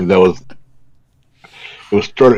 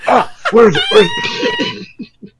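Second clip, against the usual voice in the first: frantic high squeal, laughing; mock panic, voice flying up; panicked; slower than usual. Retold panic on waking.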